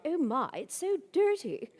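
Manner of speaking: put-on high, slow, swooping English accent; mock English accent, high and slow; fake English accent. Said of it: "in my fake English accent".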